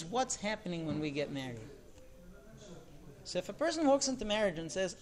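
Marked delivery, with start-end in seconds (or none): none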